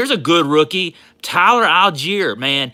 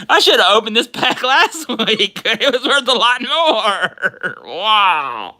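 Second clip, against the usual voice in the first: laughing; 7 semitones higher, 5 dB louder, slower than usual. Real, not acted. Laughing at timing.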